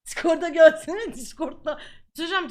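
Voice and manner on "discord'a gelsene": loud, slow, drawn-out call. Real, not acted. Inviting viewers over to his Discord, a loud slow call. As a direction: shout the invitation across the room.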